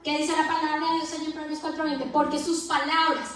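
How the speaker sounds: rising pulpit exhortation; fervent preaching. Acted. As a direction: preach it to the congregation with fire. Preaching at full force.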